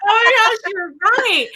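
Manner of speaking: sudden high, bright outburst of realization; excited realization. Retold or imitated. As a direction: blurt it out as it dawns on you. Realizing her son was right.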